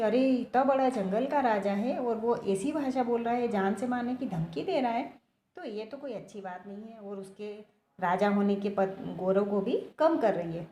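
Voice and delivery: ordinary delivery, nothing marked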